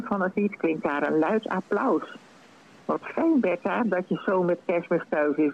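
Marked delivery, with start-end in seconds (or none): none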